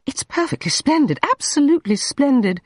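high, rushed forced praise; too-quick praise. A narrator's reading. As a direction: gush the praise a bit too fast to cover doubt. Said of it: "too quickly".

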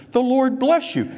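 high, ringing mock call to the workers; called-out greeting. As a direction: imitate a boss hailing his field hands. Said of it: "he shouted out to his workers".

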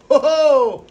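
loud, high burst of glee; excited whoop. Real, not acted. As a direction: whoop at the splashing fish. Feeding shrimp to lunging fish.

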